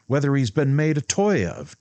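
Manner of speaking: almost shout it at her; near shout. A narrator's reading. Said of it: "George almost shouted".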